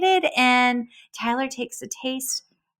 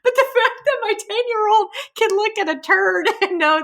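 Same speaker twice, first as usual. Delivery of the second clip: laughing, higher pitch, half crying with laughter; 10 semitones higher than usual. Real, not acted. Host telling guest about her son, laughing hard. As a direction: giggle in disbelief. Laughing at son's poop expertise.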